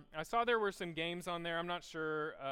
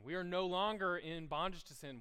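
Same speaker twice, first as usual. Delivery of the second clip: normal talking voice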